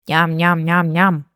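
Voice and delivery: low, slow nom-nom chewing; munch along steadily on a low note; munching sounds. A narrator's reading. Narrator imitates baby bird eating carrot, slow munching.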